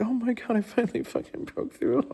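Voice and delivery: high, hushed, half-sobbing relief; mock teary joy. Recalling crying at the Tony Robbins event, mock teary joy. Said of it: "crying at Tony Robbins".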